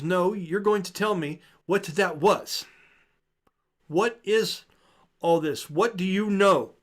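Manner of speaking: high, loud and slow, demanding answers; angry demand. Acted. Daughter yells at her father for the truth, high and loud. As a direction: make him tell you, raising your voice.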